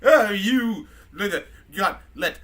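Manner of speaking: put-on high, loud, catty drunken British robot; mean drunk rant, building to an outburst; drunk C-3PO. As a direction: sneer accusations like a sloshed British droid. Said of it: "three P O's just a mean fucking drunk".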